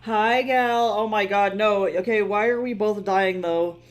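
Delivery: loud, high, delighted hello; loud excited welcome to a friend; excited greeting. Real, not acted. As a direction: burst out greeting a friend you've missed.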